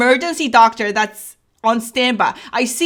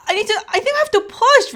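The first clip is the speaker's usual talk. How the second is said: urgent plea; 10 semitones higher than usual. Retold or imitated.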